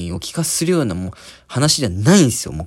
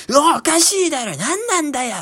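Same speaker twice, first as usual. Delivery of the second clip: much higher mock angry yelling; imitated brawl shouting; 13 semitones higher than usual. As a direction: drunks squaring off to fight. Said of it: "殴り合いの喧嘩". (殴り合い寸前の怒鳴り声の真似)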